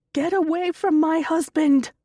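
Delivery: high, loud full scream; screaming at the top of her lungs. A narrator's reading. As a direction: scream to drive him off. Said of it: "she screams at the top of her lungs".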